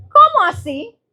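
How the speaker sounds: high, loud rising "¿Cómo así?"; disbelief. Retold or imitated. Repite la reacción asombrada. ("¿Cómo así?" agudo, subiendo, incrédula)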